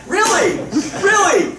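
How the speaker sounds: high, laughing "Really? Really?" in disbelief; incredulous laugh. Retold or imitated. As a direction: laugh out the double "really" in mock outrage. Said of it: "And I was like".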